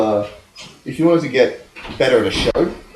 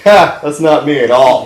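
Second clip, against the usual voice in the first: mock scoff; 3 semitones higher, 8 dB louder, slower than usual. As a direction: scoff loudly, as if rejecting the name. Acts out scoffing at the name.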